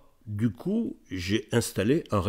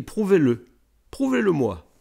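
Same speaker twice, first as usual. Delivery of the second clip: demanding lady; 8 semitones higher, 6 dB louder than usual. Retold or imitated. Retold lady's demand.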